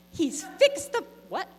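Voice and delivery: can't believe what she just heard; incredulous. Retold or imitated. Disbelief at wrong vacuum.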